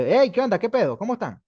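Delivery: loud, high, cheeky slang hello; slangy casual greeting. Shown as a demonstration. Teacher mocking slang greeting at a company. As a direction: barge in all buddy-buddy. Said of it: "llega como diciendo".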